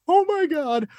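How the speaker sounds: squealing with delight at the scene; retold excitement. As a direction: relive the thrill of the moment. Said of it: "And I'm like".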